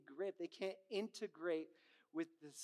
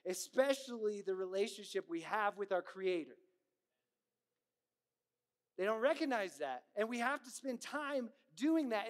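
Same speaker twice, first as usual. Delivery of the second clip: louder, driving pulpit delivery; preaching at full force; 3 semitones higher, 5 dB louder than usual. Acted. Pastor urging the congregation about relationship with God, forceful preaching. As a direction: preach it with rising conviction.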